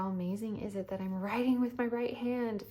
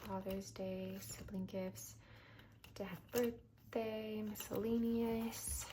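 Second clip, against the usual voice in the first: whispering; 8 dB quieter, slower than usual. Real, not acted. Reading envelope names softly.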